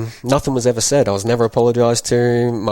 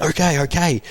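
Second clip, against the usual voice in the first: put-on higher frightened child giving in; imitating scared confession; 6 semitones higher than usual. Boy falsely confessing to stop the beating, high and scared. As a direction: cave in like a terrified kid.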